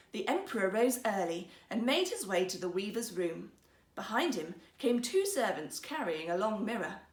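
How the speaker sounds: plain reading voice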